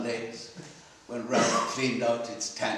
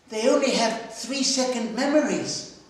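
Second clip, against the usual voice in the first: put-on little girl voice; 10 semitones higher, 4 dB louder than usual. Little girl corrects Ralph.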